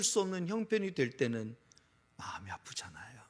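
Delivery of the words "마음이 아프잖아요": hushed aside. Real, not acted. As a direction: drop to a breathy whisper. Preacher drops to hushed aside. (속삭이듯 조용히)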